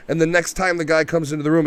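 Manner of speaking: normal talking voice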